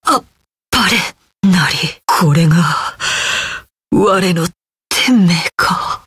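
low, halting last breath; dying words. Acted. Defeated warrior accepting fate, low and halting. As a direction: let the words sink away as life fails.

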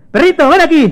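high, loud summoning call; calling the dog. Acted. Mateo calling his dog over, high and loud. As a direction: call a pet across the room.

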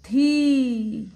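थी loud, with a long ee; long-vowel demonstration. Shown as a demonstration. Teacher joins letter and matra, long loud थी. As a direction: hold the vowel in थी, loudly. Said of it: "बड़ी की मात्रा".

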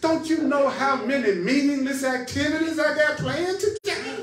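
loud, high, laughing outrage; mock indignation. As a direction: protest in hammed-up disbelief. Acts indignant American refusing stillness.

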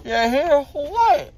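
talking around gauze pads; muffled after dental surgery; mouth stuffed with gauze. Real, not acted.

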